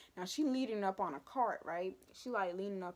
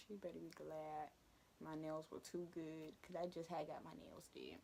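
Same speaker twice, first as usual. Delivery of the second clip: much softer, nearly whispered grumble; under-breath mutter; 4 semitones lower, 13 dB quieter than usual. Retold or imitated. Student grumbling at the teacher, barely whispered. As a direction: mutter it so nobody hears.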